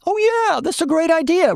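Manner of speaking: very high, gushing mock agreement; impressed agreement. Half the classroom nodding along, impressed agreement. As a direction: pitch it way up like a won-over crowd.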